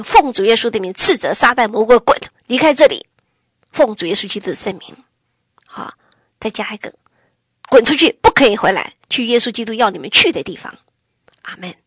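forceful loud command to Satan; rebuking prayer. Shown as a demonstration. Showing the congregation how to rebuke the devil, loud and forceful. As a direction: drive the devil out with authority. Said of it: "牧师就教我这句话".

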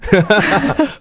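a bright, high burst of real laughter; high rising laugh. Real, not acted. Asked about spit-up on shoulder. (被问到吐奶时忍不住高声大笑)

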